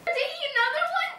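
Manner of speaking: surprised. Real, not acted. Surprised at friend.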